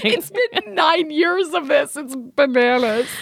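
high, slow wail through laughter; laughing exasperation. Real, not acted. Host exasperated by years of puppet-voiced neighbors, laughing high wail. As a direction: wail it while cracking up.

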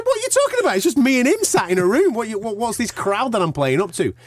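ranting back in disbelief, voice pitched high; indignant retold rant.

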